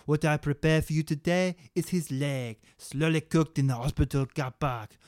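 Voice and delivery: hammed-up fake French chef; broken French accent. Chef Gary pitches his stew in mock French. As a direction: ham it up as a fake French cook. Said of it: "impersonation of a cannibalistic chef with a broken French accent".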